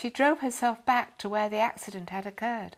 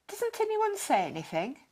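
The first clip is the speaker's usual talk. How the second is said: a mild, gently reproachful question; a touch of scolding in the question; slightly scolding; 6 semitones higher than usual. A narrator's reading. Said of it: "as if slightly scolding him".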